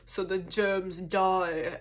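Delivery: slow, drawn-out put-on voice; imitating the anesthesiologist. Anesthesiologist explaining the cold room, slow imitation. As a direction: stretch it out as a mock explanation.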